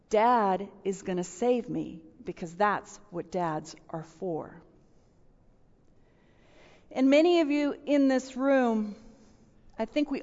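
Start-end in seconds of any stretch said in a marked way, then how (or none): 0.1-4.6 hushed repeat. Retold or imitated. Repeating the child's words.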